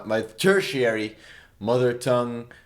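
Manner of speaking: ordinary talk, nothing marked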